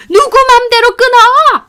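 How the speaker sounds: very high, loud scream; furious shriek. A narrator's reading. Mother-in-law as her daughter-in-law ends the call, furious shriek. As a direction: scream to stop the hang-up.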